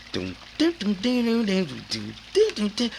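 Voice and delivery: chanted doom doom beat with high jumps; beatboxed beat. Retold or imitated. The beat he kept hearing, chanted out. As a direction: mouth the drum pattern rhythmically. Beat stuck in his head.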